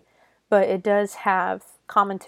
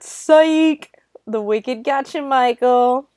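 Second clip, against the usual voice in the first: loud, high "psych!", then teasing; spring the trick on him, mock taunting; playful gotcha; 5 semitones higher, 7 dB louder, slower than usual. Reviewer revealing the fake-out to Michael, playful loud gotcha.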